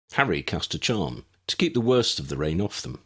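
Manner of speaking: usual speaking voice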